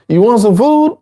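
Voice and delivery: high, upbeat offering voice; cheerful offer. Acted. Teacher voicing a friend offering food to an addict, high and upbeat.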